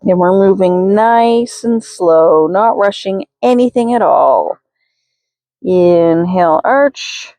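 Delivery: slow, drawn-out yoga cues; careful unhurried voice. Acted. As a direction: stretch each cue out calmly. Paced cat-cow cues.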